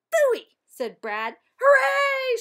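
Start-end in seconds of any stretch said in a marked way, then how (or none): 0.1-0.6 high, loud cry of disgust; scornful outburst. A narrator's reading. Brad loses the pet vote, scornful outburst. 1.6-2.4 high, loud drawn-out cheer; excited shout. A narrator's reading. Class cheers the guinea pig winning, excited shout. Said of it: "shouted the rest of the class".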